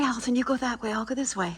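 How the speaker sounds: ordinary talk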